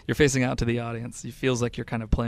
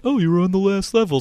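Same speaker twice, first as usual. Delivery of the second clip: higher, louder mock quote of a friend; imitating Scott; 8 semitones higher, 7 dB louder than usual. Host mimics friend during game demo, imitating him. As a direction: pitch it up, mimicking a pal. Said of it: "Scott was like".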